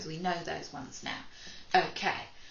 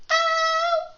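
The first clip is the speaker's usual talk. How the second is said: much higher, louder alien cry; alien squeal; 11 dB louder than usual. Pretend. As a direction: shriek it like an alien. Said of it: "my turn, your turn".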